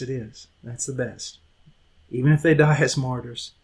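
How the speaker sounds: usual speaking voice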